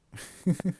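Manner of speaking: sudden stifled laugh. Real, not acted. Streamer cracks up at a delayed chat reaction, laughing. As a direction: break off into a real laugh. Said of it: "I'm laughing because".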